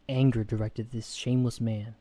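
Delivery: plain reading voice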